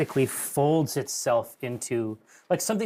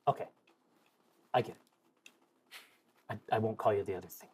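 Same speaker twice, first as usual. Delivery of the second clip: hushed, halting whisper; very soft, secretive in-character whisper; 6 semitones lower, 10 dB quieter, much faster than usual. Acted. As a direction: whisper it low and close, as if confiding. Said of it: "I'll just whisper into the dome".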